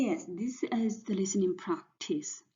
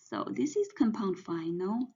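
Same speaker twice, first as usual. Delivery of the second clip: usual speaking voice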